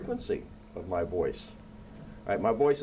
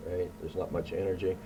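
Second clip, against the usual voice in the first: ordinary delivery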